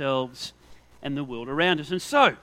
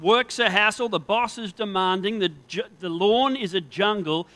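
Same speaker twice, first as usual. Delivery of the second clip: normal talking voice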